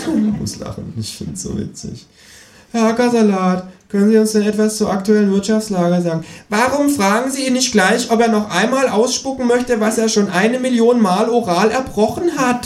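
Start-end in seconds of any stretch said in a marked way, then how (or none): 2.7-6.2 higher, louder host voice; TV presenter. Acted. Moderator questioning the economist, higher chirpy voice. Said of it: "fragt die Moderatorin". 6.5-12.6 high, louder mocking kangaroo voice; kangaroo cutting in. Kangaroo interrupting the moderator, high mocking voice. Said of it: "fährt das Känguru dazwischen".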